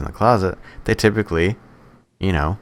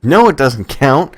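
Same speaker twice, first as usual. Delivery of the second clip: emphatic no; 8 semitones higher, 7 dB louder, slower than usual. Real, not acted. Friend dismisses Expendables answer.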